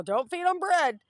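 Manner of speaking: high, loud imitation of a scolding; mock scolding. Food pantry people warning about feeding ducks, mock scolding. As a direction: imitate someone loudly telling you off.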